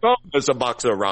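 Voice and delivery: light mock Jamaican accent; imitated Jamaican accent. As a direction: try the catchphrase with a Jamaican lilt. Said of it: "that's sort of a Jamaican accent".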